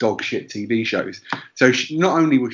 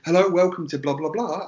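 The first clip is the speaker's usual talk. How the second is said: higher, sing-song phone welcome; call-centre greeting; 6 semitones higher than usual. Retold or imitated. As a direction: parody a rote phone greeting.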